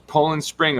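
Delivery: usual speaking voice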